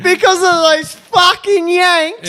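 high, slow, cracking up through the line; mock outrage lost in laughter; laughing repeat. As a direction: repeat it while falling about laughing. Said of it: "they told the tradies".

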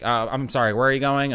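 a hint of the eager driver asking; mild imitation of the overfamiliar driver; chatty driver. Voicing the driver again.

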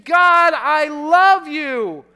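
slow, high, full-voiced declaration of love; calling out praise. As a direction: call it out to God, stretching the words. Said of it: "you're calling out his goodness".

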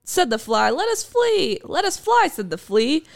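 playful sing-song recital; bouncy limerick lilt. Acted. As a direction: bounce through the rhymes. Recites tongue-twister limerick.

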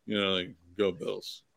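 mumbled, trailing off; flat mumble. Retold or imitated. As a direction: throw the line away, dropping it. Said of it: "you don't just drop it".

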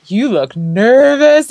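lightly voiced husband stating the obvious; imitating husband. Husband's remark voiced in story.

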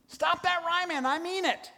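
high, put-on scolding voice; movie character quote. Preacher quoting The Princess Bride in a mock scolding voice.

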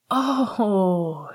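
slow, drawn-out gasp of surprise; breathed surprise. A narrator's reading. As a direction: breathe it out in wonder. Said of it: "she breathed".

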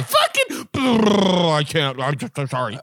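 sputtering, garbled apology; flustered stumble. Pretend. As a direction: pretend you can't get the words out. Acting out getting tongue-tied.

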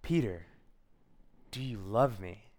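low, measured, tender question; gentle Jesus. Acted. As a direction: ask it calmly, with space after the name. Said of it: "and Jesus asks him".

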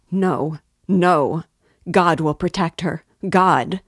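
loud, frantic repeated denial; beside herself. A narrator's reading. Sonia insisting God will protect her sister, frantic and loud. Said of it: "beside herself".

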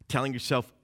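usual speaking voice

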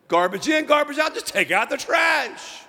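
rally the congregation; fervent pulpit shout; preaching at full force. Acted. Preaching call to action.

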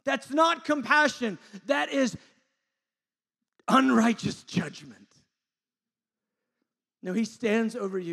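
high, very loud preacher's voice; impassioned preaching. Real, not acted. Preacher urging a torn soul to know God, impassioned. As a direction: preach it at full force.

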